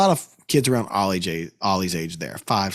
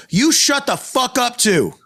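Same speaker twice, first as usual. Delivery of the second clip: retold yell; 11 semitones higher, slower than usual. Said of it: "and I go".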